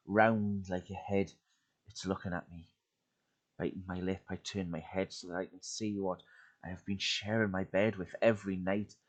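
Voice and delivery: ordinary delivery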